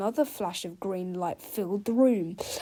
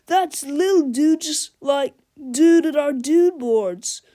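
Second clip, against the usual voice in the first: surfer dude; 5 semitones higher, 9 dB louder, slower than usual. A narrator's reading. Skateboarder surfer voice.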